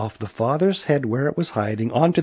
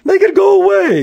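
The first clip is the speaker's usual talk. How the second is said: very high, loud frantic yell; 8 dB louder than usual. A narrator's reading. Policeman desperate to lose the hair, frantic yelling. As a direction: yell it in high-pitched panic. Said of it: "yelled the policeman".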